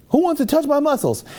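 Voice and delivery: very high-pitched mock invitation; Ronnie Coleman. Lifter inviting hundreds of kids over, imitated high call.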